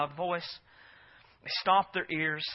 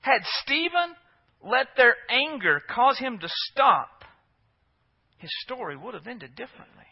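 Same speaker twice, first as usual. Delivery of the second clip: high, forceful pulpit delivery with hard-hit key words; raised, emphatic sermon voice; impassioned preaching; 7 semitones higher, 7 dB louder than usual. Real, not acted. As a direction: drive the point home from the pulpit. Preacher presses the point.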